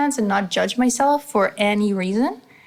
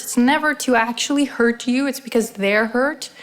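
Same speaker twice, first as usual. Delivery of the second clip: ordinary talk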